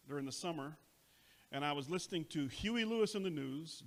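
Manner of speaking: usual speaking voice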